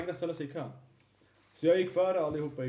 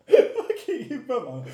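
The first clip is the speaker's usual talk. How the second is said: slow, higher, laughing exclamation; 7 semitones higher, 9 dB louder, much slower than usual. Real, not acted. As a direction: laugh his friend's name out. Laughing at Ibbe. (skrattande utrop om Ibbe)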